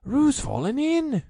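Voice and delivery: high, loud alarmed cry; Rabbit crying out. A narrator's reading. Rabbit calling out that Roo fell, high alarmed cry. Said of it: "cried Rabbit".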